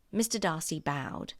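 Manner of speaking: usual speaking voice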